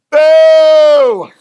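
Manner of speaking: huge high jeering boo; loud boo. Retold or imitated. Pastor retelling booing line-cutters, loud jeering boo. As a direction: boo them loudly off the line.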